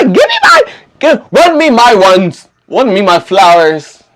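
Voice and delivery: high, slow theatrical demand; hammed-up demanding. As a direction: stretch it out, playfully claiming your due. Acted demand for praise.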